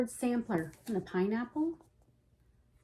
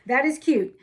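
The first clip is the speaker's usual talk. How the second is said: louder, stressing "is" in "That is cute"; a louder burst of "That is cute"; emphatic louder repeat; 2 semitones higher, 8 dB louder than usual. Real, not acted. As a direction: punch out "That is cute" with delight. Repeats the praise twice.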